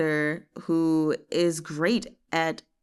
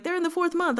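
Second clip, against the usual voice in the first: high-pitched surprised note; suddenly noticing, voice jumping up; surprised realization; 11 semitones higher, faster than usual. Retold or imitated. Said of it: "I was like".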